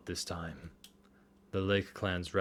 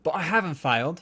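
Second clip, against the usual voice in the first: put-on higher, louder British accent; 7 semitones higher, 5 dB louder than usual. [name] insists to Leith he hasn't failed, in a British accent. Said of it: "Everyone's gonna have a British accent".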